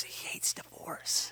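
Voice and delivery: urgent, voiceless whisper of a correction; groom's whisper. Retold or imitated. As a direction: hiss the word to the pastor under your breath. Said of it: "And he goes".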